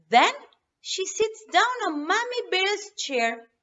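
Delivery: usual speaking voice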